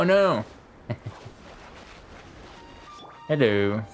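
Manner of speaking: ordinary delivery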